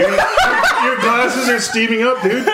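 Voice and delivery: laughing through the words, slow; cracking up while talking. Real, not acted. Laughing at friend sweating.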